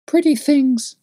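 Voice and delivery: put-on high excited child's voice; enthusiastic little girl. A narrator's reading. Little girl shows the man her shiny stones, high and eager. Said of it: "cried the little girl enthusiastically".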